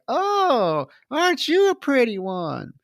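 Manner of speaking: high-pitched mock coo; acted flirty greeting. Evelyn greeting the newlywed husband, imitated flirty coo. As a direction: play her cooing at a guest, high.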